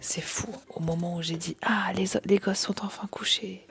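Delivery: soft unvoiced whisper. Real, not acted. Kids asleep, whispering.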